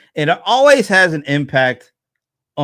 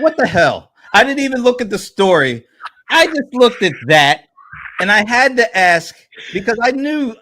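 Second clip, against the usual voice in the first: higher and louder throughout; animated disbelief; 9 semitones higher, 4 dB louder than usual. Real, not acted. Host to guest about his morning photo, excited. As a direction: tell it with worked-up amazement. Host reacting to a shocking face.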